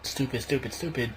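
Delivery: normal talking voice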